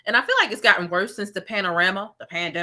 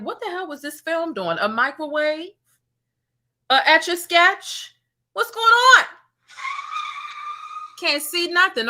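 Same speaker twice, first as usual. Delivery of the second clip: rising higher and louder into mock outrage; higher, louder mocking disbelief; incredulous mockery; 9 semitones higher, 4 dB louder than usual. Real, not acted. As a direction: pile on the disbelief, voice climbing to a shriek. Mocking the blurry video quality.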